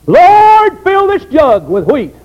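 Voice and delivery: put-on old woman's high pleading prayer; praying woman. Old woman praying to the Lord, pleading loudly.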